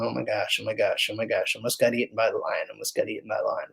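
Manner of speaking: soft, fast, fretful muttering; hushed rapid mock worry; mock panic. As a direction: rattle it off under your breath like a nervous wreck. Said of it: "he's not sitting there going".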